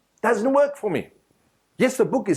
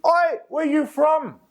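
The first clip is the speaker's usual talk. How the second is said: high, slow calling-out shop tout voice; street hawker call; 12 semitones higher, slower than usual. Retold or imitated. Turkish shopkeeper hails a tourist passing in the street, high slow call.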